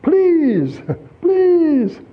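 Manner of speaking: high, pleading please; begging plea. Retold or imitated. Preacher mimicking the beggar's high pleading please. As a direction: beg for coins. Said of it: "You know what that word means?".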